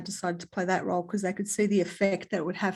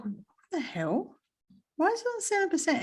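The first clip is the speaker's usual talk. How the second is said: 1 surprised; 8 semitones higher, 5 dB quieter than usual. Retold or imitated. Retold phone battery shock.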